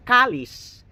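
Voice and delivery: kalis, the final S said clearly. Shown as a demonstration. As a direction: say "kalis" and sound the S at the end. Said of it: "K A L I S".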